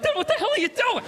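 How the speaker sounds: high, startled whisper-shout; alarmed. Retold or imitated. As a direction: recoil in disbelief.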